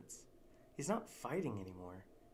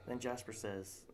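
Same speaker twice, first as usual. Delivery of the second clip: normal talking voice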